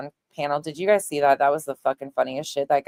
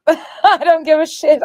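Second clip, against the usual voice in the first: high, loud, laughing it out; 14 semitones higher, 10 dB louder, slower than usual. Real, not acted. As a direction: laugh through the words. Laughs off the drama.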